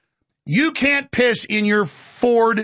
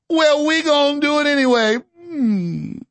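higher, stubborn mock Cajun executive; Cajun accent; 6 semitones higher, slower than usual. Executive brushing off engineers' warning, mock Cajun accent. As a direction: dig in with a put-on Cajun accent. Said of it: "executive in the coon-ass voice".

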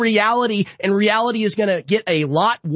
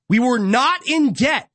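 ordinary talk, nothing marked

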